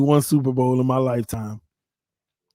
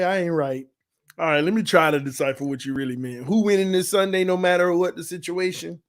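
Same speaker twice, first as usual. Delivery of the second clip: ordinary talk, nothing marked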